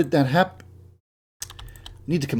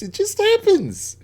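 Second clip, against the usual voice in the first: a much higher, squeaky mock-innocent voice; mock innocent squeak. Joking about chopping up bodies.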